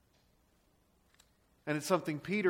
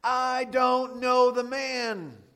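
slow, high, loud shout; desperate sworn denial; 8 semitones higher, 10 dB louder, much slower than usual. Acted. Peter curses himself and denies Jesus, a drawn-out shout. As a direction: shout every word long and high. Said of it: "He's calling down curses on himself".

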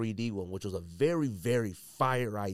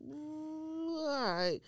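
much higher, very slow put-on voice; mock grudging approval; much slower than usual. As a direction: drag it out like a reluctant nod. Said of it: "Then the two was like".

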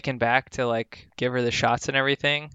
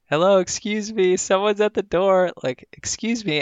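higher, raised calls toward the empty desk; calling out; 7 semitones higher than usual. Retold or imitated. To the empty front desk, a raised calling out. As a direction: call for staff to come out.

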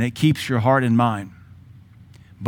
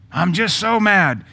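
mock whiny complaint; 6 semitones higher than usual. Mocking an angry person's complaint.

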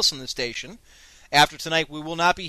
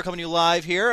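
ordinary talk, nothing marked

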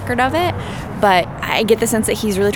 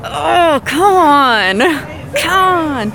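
exasperated; 9 semitones higher, 5 dB louder, much slower than usual. Retold or imitated. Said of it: "'cause I'm like".

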